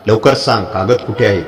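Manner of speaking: ordinary delivery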